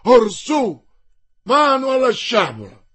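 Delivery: carried away by the fight, yell it; intoxicated battle cry. A narrator's reading. The king orders sabres drawn, loud and exalted. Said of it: "gridò il re, inebriato".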